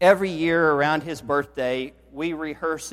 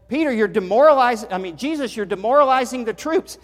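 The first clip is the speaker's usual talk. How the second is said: higher, louder, fast protest; livid Peter; 8 semitones higher, 7 dB louder, faster than usual. Acted. Peter to Jesus over the troops, livid and loud. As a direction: Peter scolding Jesus in mock outrage.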